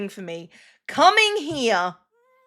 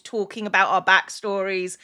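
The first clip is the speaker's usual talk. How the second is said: usual speaking voice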